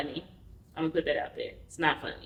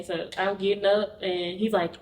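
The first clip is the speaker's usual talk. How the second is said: ordinary talk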